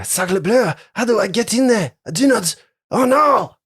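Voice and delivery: put-on high, panicky French accent; flustered French chef, rising to a squeal. DM voices panicking French character.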